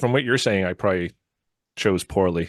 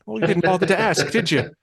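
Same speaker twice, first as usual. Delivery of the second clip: much higher snippy peasant retort; Monty Python peasant; 9 semitones higher than usual. Retold or imitated. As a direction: snap back like Dennis. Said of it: "Monty Python and the Holy Grail".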